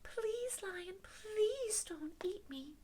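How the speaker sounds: tiny high squeaky-small voice; pleading mouse. Acted. Mouse begs lion not to eat it, pleading mouse. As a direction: beg in a little high mouse voice. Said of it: "The mouse says".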